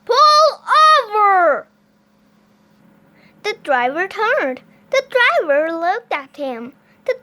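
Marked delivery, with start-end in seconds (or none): 0.1-1.7 very high, very loud command; bullhorn shout. A narrator's reading. Officer orders a driver over, high full shout. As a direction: bellow it as a police order. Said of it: "He shouted into his bullhorn".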